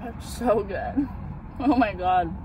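chewing while talking; talking between bites; mouth full of donut. Real, not acted. Seen 0: Ate donut just before; mouth closed, chewing while praising it.

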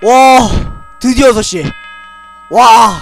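loud joyful cheer; relieved cheer. Real, not acted. Relief at surviving the night.

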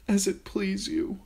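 put-on frightened girl giving up; weak submission. A narrator's reading. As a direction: trail off in defeat. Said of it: "she finished weakly".

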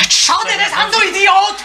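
high-pitched angry rebuke; scolding. Acted. Woman berating the man who spied, angry scolding. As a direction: lash out at him in reproach. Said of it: "Bitte nicht schimpfen".

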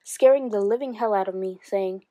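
ordinary delivery

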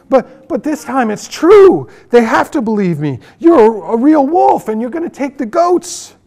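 high, loud, rushed pleading; panicked pleading. A narrator's reading. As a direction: beg them to believe you. Frightened boy pleads.

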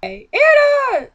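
high, slow, stretched-out "Hey, Anna!"; hammed-up eager greeting; mock sing-song call. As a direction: call her name way up high and drawn out. Character calls out across the store.

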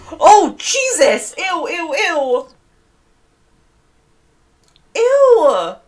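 high yelp of revulsion; yelp, then squeal in disgust; startled disgust. Real, not acted. Player scared by a gross wildlife encounter, startled high squeals.

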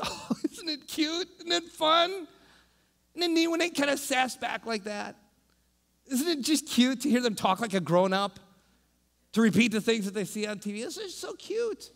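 put-on high gushing coo over the kids; voice of Happy Now. Preacher voicing Happy Now, gushing high. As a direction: coo it up high like a doting tempter. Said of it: "Happy Now says".

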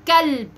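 كلب with a light, thin front-of-qaf K; light kaf. Shown as a demonstration. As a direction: say كلب without force, kaf kept light. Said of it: "لو هنطق بقى حاجة بالكاف". (كاف مرققة)

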